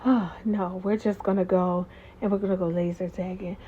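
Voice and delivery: low, soft letdown after a big exhale; deep breath out. Retold or imitated. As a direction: blow it out, then speak low. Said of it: "takes this deep breath".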